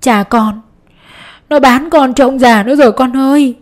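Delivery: put-on a mother sobbing out that the boy was sold; crying while speaking. A narrator's reading. Mother tells her son he was sold, crying. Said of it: "vừa khóc vừa nói rằng".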